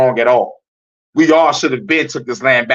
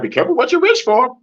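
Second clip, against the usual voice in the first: very high squeaky mock voice; high-pitched mimicry of cautious people; mocking naysayers; 13 semitones higher than usual. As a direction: parrot their warning in a silly falsetto. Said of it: "Motherfuckers be like".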